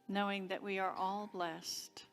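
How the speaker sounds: normal talking voice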